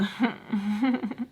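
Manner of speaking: quiet, nervous little chuckle; nervous laugh. Retold or imitated. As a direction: imitate a soft, uneasy giggle.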